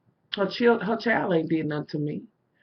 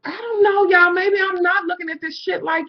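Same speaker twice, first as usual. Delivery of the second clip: crying; 10 semitones higher, 5 dB louder than usual. Real, not acted. Upset venting to viewers.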